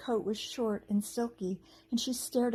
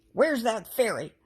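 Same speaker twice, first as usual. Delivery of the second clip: loud goblin call; shouted; 3 semitones higher, 7 dB louder than usual. A narrator's reading. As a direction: bark it out as a hunting goblin. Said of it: "one of them shouted".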